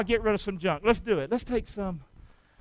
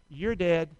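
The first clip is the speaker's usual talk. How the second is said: normal talking voice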